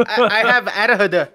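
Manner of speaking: "adhd" slurred as a word, ad-huh-duh; loud, slow "adhd" pronounced ad-huh-duh; ADHD as one word. Pretend. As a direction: run "adhd" together instead of spelling it. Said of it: "say A D H D again the way you did".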